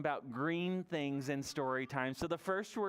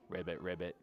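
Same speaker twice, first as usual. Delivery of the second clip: low frog "ribbit"; deep, playful frog noises; frog croak; 9 semitones lower, 3 dB quieter than usual. Pretend. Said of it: "This is frog in sign language".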